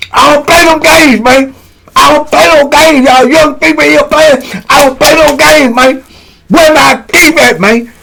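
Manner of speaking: high, toothless mock-angry rant; deadly serious outburst. Inmate warning youngins to stop playing, deadly serious. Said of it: "Because he talking like this, but he deadly serious".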